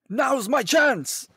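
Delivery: loud, drawn-out mock heroic callout; overdone game-character voice, pitched high; hunter battle cry. Imitating own hunter sharpening his weapon, hammed-up shout. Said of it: "does he say stuff".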